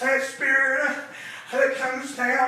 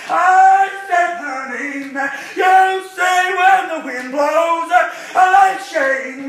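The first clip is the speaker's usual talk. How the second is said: preaching; 6 dB louder than usual. Acted. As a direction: preach it out to the congregation with rising fire. Preacher chanting to congregation.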